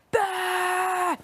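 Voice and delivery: loud high squawking animal cry; dolphin call. Real, not acted. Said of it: "I sound like a fucking turkey".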